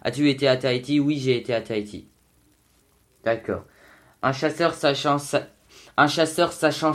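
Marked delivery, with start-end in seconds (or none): 0.0-2.1 quick tongue-twister rattle; said fast. Shown as a demonstration. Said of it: "quand on dit ça rapidement". (très rapide)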